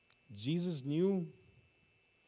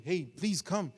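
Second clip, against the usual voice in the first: half-hearted yelled plea, raised a little; begging yell; 6 semitones higher, 5 dB louder, slower than usual. Acted. Homeless blind man begging Jesus to come, mild yell. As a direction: lightly imitate a beggar calling out. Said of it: "begging, crying, yelling".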